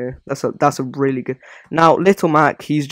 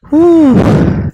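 high loud whoo; 11 dB louder than usual. Real, not acted. Relief sound after fixing.

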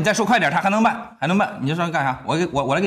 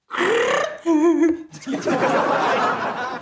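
high cackling laugh; big laugh; 14 semitones higher, 3 dB louder than usual. Real, not acted. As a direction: burst out cackling. Bursts out laughing.

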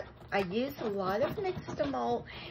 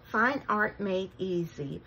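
normal talking voice

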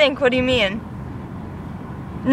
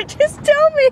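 giggling plea; 13 semitones higher than usual. Real, not acted. Begging through giggles.

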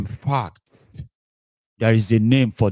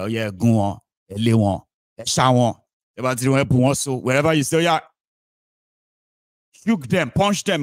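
mock crowd egging on; 3 semitones higher than usual. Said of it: "you can they shout like".

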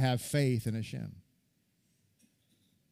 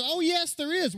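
mock eager rabbi; 13 semitones higher than usual. Mocking a rabbi's eager answer.

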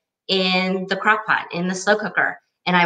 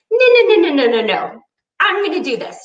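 loud, much higher pleading no; frantic no; 9 semitones higher, 4 dB louder than usual. Retold or imitated. Begging the producer not to call back, frantic. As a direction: stop her hanging up, frantic.

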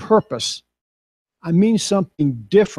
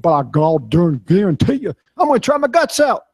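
Ty Murray's voice; 2 semitones higher than usual. Retold or imitated. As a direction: imitate Ty rising to a fast, fervent pitch. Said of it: "He talks this way".

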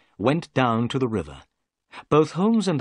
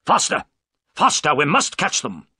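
loud, urgent orders; urgent shouting; 4 semitones higher, 5 dB louder than usual. A narrator's reading. Holmes urges the police crew to go faster, shouting. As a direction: drive the crew on.

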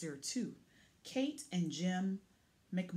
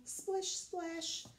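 high, light playful sound words; sing-song splash; 8 semitones higher, 5 dB quieter than usual. Acted. Truck splashes through puddle.

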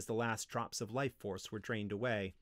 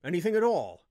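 loud, raised, insistent questioning; demanding; 6 semitones higher, 6 dB louder than usual. A narrator's reading. Wizard demanding what the slave senses, insistently. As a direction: press hard for an answer. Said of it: "Noraim demanded".

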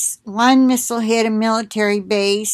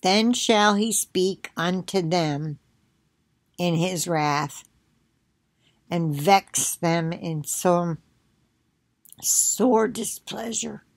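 slow, low, soft Bible reading; scripture reading; 3 semitones lower, 5 dB quieter, slower than usual. Real, not acted. Resuming the psalm after coughing, subdued scripture reading. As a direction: read it softly and slowly, saving your voice.

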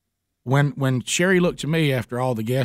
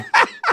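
high burst of laughter; 3 dB louder than usual. Real, not acted.

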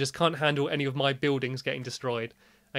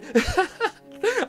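high nervous laugh; 4 dB louder than usual. Real, not acted. Laugh mid ghost-train ride.

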